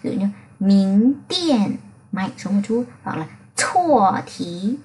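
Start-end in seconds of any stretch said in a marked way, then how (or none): 0.6-1.8 "Mính Tiện" said slowly, pressed down; slow stressed fourth tone. Shown as a demonstration. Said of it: "đọc chậm chậm thôi và ... nhấn mạnh xuống". 3.5-4.8 "Chua Thí" drawn out very slowly with weight on "Thí"; very slow stressed tone. Shown as a demonstration. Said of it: "phải nhấn mạnh hơn nhé".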